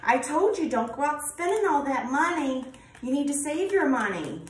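scolding I told you so. Retold or imitated. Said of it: "It's like that kind of".

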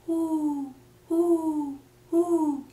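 soft, high owl hoots; owl hooting. Shown as a demonstration. Teacher shows a real owl's call, soft hooting. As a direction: hoot gently like an owl, three times. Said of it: "¿Qué dice la lechuza de verdad?".

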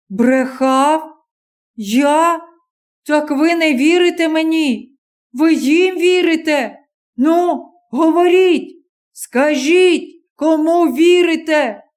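put-on young stranger pleading with the crowd to believe him; indignant young man. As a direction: push back, hurt and insistent.

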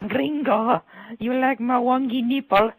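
put-on high, drawn-out Spanish-accented come-on; mock Spanish accent. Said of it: "like, the fat fucking Spanish bitches".

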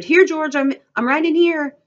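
high, loud, eager answer; calling back. A narrator's reading. Lenny answers George from Crooks's room, calling back. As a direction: call back eagerly so you can be found. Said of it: "he answered".

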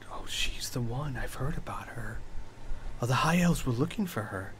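hushed, whispered gossip; crowd mutters. Acted. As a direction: pass a rumour round under your breath. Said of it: "you do hear mutters of".

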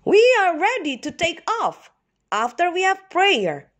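a bright leader rallying the flock; Daddy Duck voice. A narrator's reading. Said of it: "quacked Daddy Duck".

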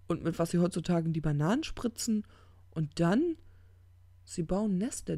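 worked-up tirade with stumbles; ranting demon. Acted. Crowley rants about the doomed.

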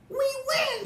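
very high, loud victory squeal; triumphant scream. Acted. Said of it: "screamed the guests".

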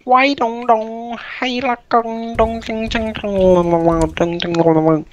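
high fake-Chinese gibberish, falling gradually; mock Chinese accent. Friend asks him to do Chinese, mock Chinese accent. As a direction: babble in a caricatured Chinese sing-song. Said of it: "Do Chinese".

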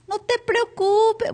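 very high, slow, sing-song "No te preocupes"; mock reassurance. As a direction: lampoon a friend's useless comfort. Mocking reply to tumor news.

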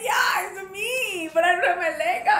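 loud, high half-crying wail; mock tearful wail. Bride wails to her friend that her outfit is missing. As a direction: whine it like a teary bride.

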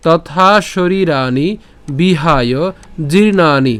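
slow, firm model reading; pronunciation demo. Shown as a demonstration. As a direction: sound out তথা শরীরানি, বিহায়, জীর্ণানি piece by piece. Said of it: "ওই যে বলেছিলাম". (ধীরে, জোর দিয়ে স্পষ্ট উচ্চারণ)

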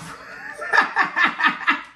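high breathy burst of laughter; laughing at herself. Real, not acted. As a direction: crack up at your own mistake. Laughs at own misreading.

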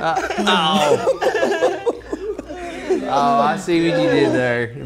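laughing. Real, not acted. Laughing at pun.

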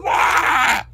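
high-pitched cartoon wail; hammed-up wailing shriek; mock wail. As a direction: let out an exaggerated high cry. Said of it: "He goes".

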